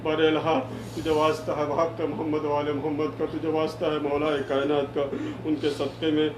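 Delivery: low, soft congregational dua; supplicatory prayer. Real, not acted. Leads closing supplication. (دھیمی، نیچی آواز میں عاجزانہ دعا)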